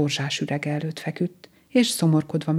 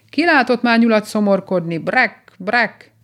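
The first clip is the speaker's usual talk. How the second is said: higher, louder croaky call; unpleasant-voiced frog; 5 semitones higher, 6 dB louder than usual. A narrator's reading. Frog hailing the moping rabbit, unpleasant cry. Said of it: "kiáltott fel mellette egy kellemetlen hangú kecskebéka".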